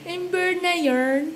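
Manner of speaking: laughing through the words. Real, not acted. Parent laughing at the sulky baby, laughing. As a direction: giggle it out.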